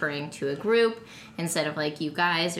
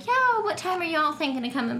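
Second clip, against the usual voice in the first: much higher, breezy phone chat; casual phone voice; 10 semitones higher than usual. Retold or imitated. Speaker on the phone making plans, high casual voice. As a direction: imitate yourself calling out to friends. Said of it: "especially when I'm on the phone".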